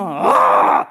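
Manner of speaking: a raw shouted Aah at the sky; anguished shout. Retold or imitated. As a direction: cry out in frustration. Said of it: "గట్టి గట్టిగా అరుస్తున్నా".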